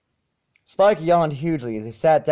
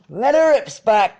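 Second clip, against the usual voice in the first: much higher, loud holler; 10 semitones higher, 6 dB louder than usual. A narrator's reading. Applejack hollers at Spike from far off. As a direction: yell it across the field. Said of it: "Applejack hollered".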